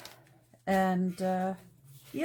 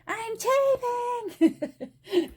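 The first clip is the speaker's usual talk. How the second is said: mock yell; 5 dB louder, much slower than usual. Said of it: "you might hear me yell".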